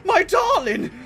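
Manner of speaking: hammed-up wailing lover; overacted heartbroken cries; melodramatic grief. Killer mourns hooked survivor, melodramatic.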